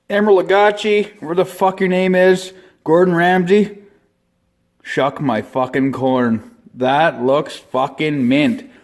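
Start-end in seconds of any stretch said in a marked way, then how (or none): 4.8-8.7 slow savouring. Acted. Savouring the finished dish.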